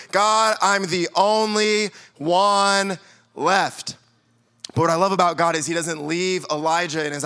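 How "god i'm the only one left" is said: very slow, drawn-out mope; mock self-pity. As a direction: stretch every word like a sulking prophet.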